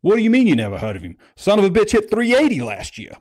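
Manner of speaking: hammed-up Southern fan indignation; incredulous Louisiana fan. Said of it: "And he goes".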